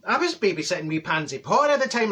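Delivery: ordinary talk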